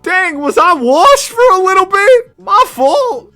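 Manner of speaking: mock dramatic. Joking about being washed.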